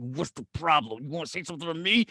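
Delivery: low, quiet menace; imitated intimidating stranger, low and unhurried; scary tough guy. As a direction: challenge him softly in a deep voice. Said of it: "it's the scariest motherfucker you ever seen".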